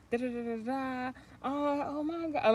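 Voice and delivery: high, rising oh my God; excited disbelief. Real, not acted. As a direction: squeal in disbelief. Retelling shocking scene.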